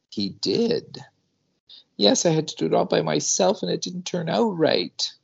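put-on a toddler sniffling through complaints; snuffling child. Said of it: "snuffles and snuffs and says".

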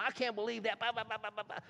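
sneering imitation of online outrage; mock outraged post. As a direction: parody the indignant poster. Mocking an online rant.

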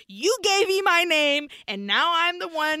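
defiant declaration. Acted. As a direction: proclaim it as she takes control. Acts heroine turning on devil.

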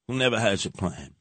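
normal talking voice

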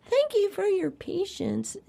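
high, sing-song automated voice; mock the hold-line recording; recorded phone message. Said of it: "a recording that says".

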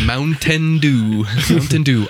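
Mountain pronounced "moun-TEN", T fully sounded; slowly overpronounce Mountain with a crisp T; teasing, careful "Moun-ten Dew"; mock over-enunciation. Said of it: "not a D".

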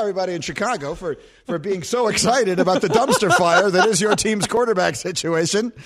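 ordinary talk, nothing marked

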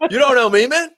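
loud, high mock tough guy; fake tough guy swagger. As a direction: puff up and bark it out. Mock brag about being unknown.

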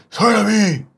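high, loud gravelly-free hero growl; Batman voice. Retold or imitated. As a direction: bark it like a masked vigilante. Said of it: "Remember, he's like".

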